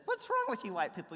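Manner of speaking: a touch of Luis's frustration; exasperated Luis. Retold or imitated. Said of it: "comes into Brother Gregory and says".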